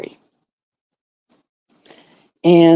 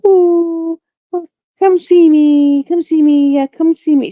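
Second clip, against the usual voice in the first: high, louder pleading puppy voice; voicing the dog; 9 semitones higher, 4 dB louder than usual. Owner voicing her puppy begging the brother's friend. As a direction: beg like the puppy at the door. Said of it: "sit outside the door going".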